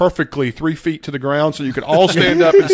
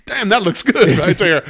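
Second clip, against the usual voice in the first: mock pleased director, a bit louder; hammed-up self-satisfied praise with a laugh; smug approval; 4 semitones higher, 5 dB louder than usual. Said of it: "somebody actually looked at that and said".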